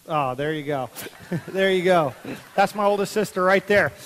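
usual speaking voice

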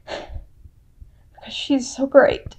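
slow, choked whisper through real tears; tearful whisper. Real, not acted. Speaking of her goddess, overcome with tears, whispering. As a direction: barely get the words out while crying. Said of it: "I kinda tear up".

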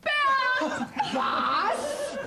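shrill outraged cry, voice jumping way up; outraged shriek. Acted. Wife hearing the farmhand named heir, shrieking in shock. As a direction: blurt it out in shocked disbelief.

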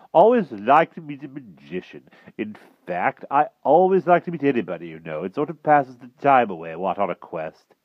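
high, loud, chatty knight; affable King Pellinore. A narrator's reading. Knight king makes small talk with magician, affable.

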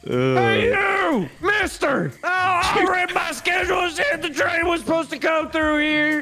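yelling cowboy. Acted. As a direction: call out to the conductor, hammed-up. Yelling at the conductor in game.

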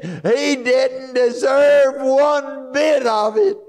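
high, loud preacher cry; full-force preaching. Acted. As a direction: preach it out with rising fire, pushing hard. Preaching the crucifixion.

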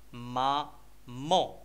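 very slow "مامون", ma, pause, mon; split into syllables. Shown as a demonstration. Teacher reading a picture word to children, "مامون" slowly in separate syllables. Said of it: "لي سيلاب اللي كيتكونوا منهم".

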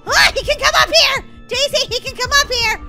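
high, loud cry of alarm; panicked yelling. Acted. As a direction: shriek a warning to your friend. Acted panic, doll climbing.

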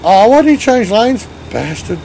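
ordinary talk

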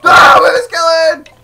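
sudden loud high scream of alarm; startled shout. Real, not acted. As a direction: yell in sudden fright. Startled by skeleton.

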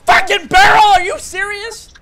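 very high, loud shout of disbelief; incredulous gamer's yell; outraged scream. Real, not acted. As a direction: scream it, then stretch the question in disbelief. Killed by exploding barrel.

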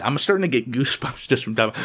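normal talking voice

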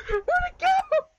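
high loud startled question; surprised. Real, not acted. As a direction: squeak it out in shock. Surprised bottle vanished.

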